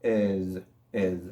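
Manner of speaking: "Es" ending in a buzzed Z; low, demonstrated "es" with a final Z; Z sound. Shown as a demonstration. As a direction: say "es" as "ez", with a voiced ending. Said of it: "ese sonido como de Z".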